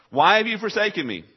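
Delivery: a loud, high cry of abandonment; anguished cry. Acted. Repeated cry of Jesus.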